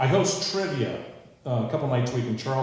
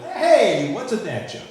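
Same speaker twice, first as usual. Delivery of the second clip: higher, brighter mock-innocent question; 9 semitones higher, 7 dB louder, slower than usual. Dad playing dumb to his daughter, higher mock-innocent. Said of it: "And I was like".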